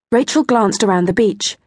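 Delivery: ordinary delivery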